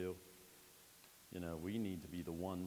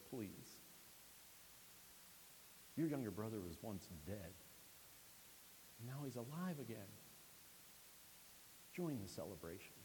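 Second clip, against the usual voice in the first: gentle plea; 7 dB quieter than usual. Acted. Father softly urging the angry son to come in. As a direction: coax him in quietly.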